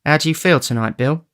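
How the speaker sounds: gently asking the sick man; concerned girl asking after Bill; Nancy. Acted. Nancy asks Sikes.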